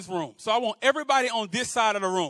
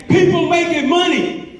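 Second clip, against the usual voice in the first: slow, high, loud chant lead; yell; 6 semitones higher, 8 dB louder, slower than usual. Shown as a demonstration. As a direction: yell it out for the crowd to echo. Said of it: "I want you to yell".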